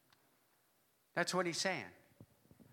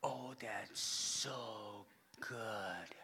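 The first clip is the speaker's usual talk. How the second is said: slow, breathy whisper of delight; hushed, savoring whisper; awed whisper; 10 semitones lower, 13 dB quieter, much slower than usual. Retold or imitated. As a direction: whisper it like the best bite ever. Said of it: "that you just go".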